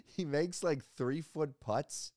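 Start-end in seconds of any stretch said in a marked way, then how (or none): none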